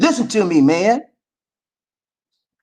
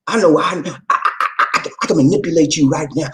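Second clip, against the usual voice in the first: ordinary talk, nothing marked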